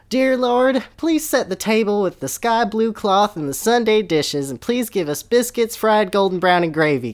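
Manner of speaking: high, loud, excited prayer for food; mock prayer. The Reverend's wife praying the table full in a high gushing voice. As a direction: plead to the Lord for a feast.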